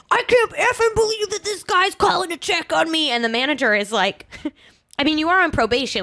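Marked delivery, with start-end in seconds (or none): 0.1-3.1 higher, put-on voice of a man losing it; mock his over-the-top fury; imitated rant. The date ranting about his boss, mock fury. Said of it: "he's just losing his mind".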